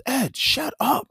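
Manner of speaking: high-pitched, drawn-out mock yell; coach yelling. Coach cutting off an arguing player after practice, mock yelling. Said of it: "What you yelling at me for".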